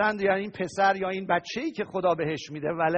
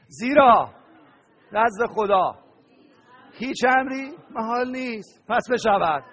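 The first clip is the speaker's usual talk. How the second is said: louder, then slow on هیچ امری محال نیست; loud proclamation; 4 semitones higher, 7 dB louder, slower than usual. Shown as a demonstration. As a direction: model the answer for everyone to shout. Congregation's answer modelled loudly.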